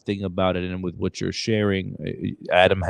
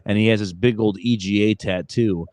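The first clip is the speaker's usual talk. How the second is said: ordinary talk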